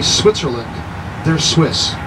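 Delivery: ordinary talk, nothing marked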